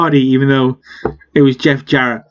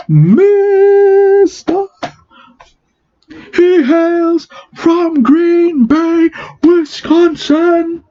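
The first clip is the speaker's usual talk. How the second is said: ring announcer; 9 semitones higher, much slower than usual. Retold or imitated. Ring entrance, lights go out.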